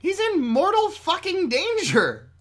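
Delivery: high, loud, drawn-out insistence; emphatic yell. Real, not acted. Co-host insisting on Usagi's danger, slow emphatic yell. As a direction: hammer it slowly at a shout.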